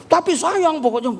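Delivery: high, stubborn whiny plea; insistent wife. Retold or imitated. Wife brushing off her husband's explanation, stubborn and high. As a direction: refuse to hear any reason.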